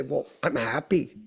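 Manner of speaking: soft, low mock reply; mock smug contentment. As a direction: toss it off quietly, a bit smug. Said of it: "Now people say".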